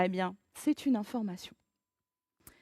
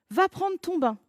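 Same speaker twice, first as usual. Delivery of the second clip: bossy order; 7 semitones higher, 5 dB louder than usual. Acted. Parent ordering a child.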